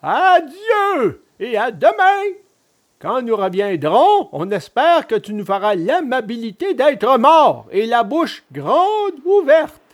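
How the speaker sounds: mocking, high villain farewell; sneering. A narrator's reading. Assassins taking leave of the hanged puppet, sneering. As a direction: stretch the goodbye with sneering false courtesy. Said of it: "lui dirent en ricanant".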